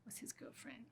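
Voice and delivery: whispered like a proud secret; hushed boast. Acted. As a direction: drop to a whisper.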